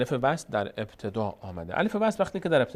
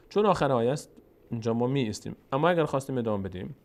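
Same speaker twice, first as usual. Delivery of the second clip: normal talking voice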